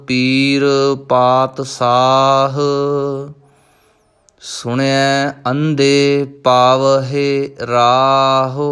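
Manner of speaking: slow, measured recitation of scripture; Gurbani recitation. Shown as a demonstration. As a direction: intone each line with devotion. Recites Gurbani lines in lesson. (Gurbani ਦਾ ਸਹਿਜ ਪਾਠ)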